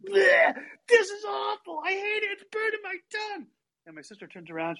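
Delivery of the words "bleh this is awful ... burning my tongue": put-on a kid wailing that the chili burns, pitch way up; whiny complaining. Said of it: "he goes".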